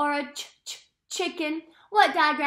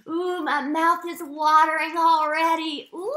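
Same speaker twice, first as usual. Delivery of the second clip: excited; 4 semitones higher, 7 dB louder than usual. Acted. Teacher excited over chocolate picture.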